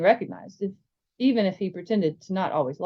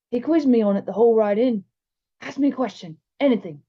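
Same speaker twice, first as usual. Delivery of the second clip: slightly raised, keen schoolboy voice; earnest bigger boy; 4 semitones higher than usual. Acted. Bigger boy shows off his Hogwarts reading, boyishly earnest. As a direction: play a boy proud of his studying.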